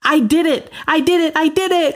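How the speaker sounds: high, bright triumphant announcement; bursting with pride, repeat it joyfully; proud and excited. Real, not acted. Announces finishing her race.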